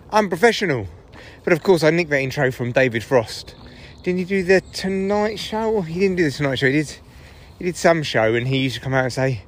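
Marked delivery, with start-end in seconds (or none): none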